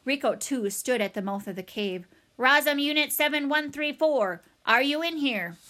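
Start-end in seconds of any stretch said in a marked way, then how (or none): 2.4-5.6 raised, level robotic call; flat robot voice. A narrator's reading. Robot calling into the cave for Roz, flat voice. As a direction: call out evenly like a machine. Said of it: "his own flat voice echo".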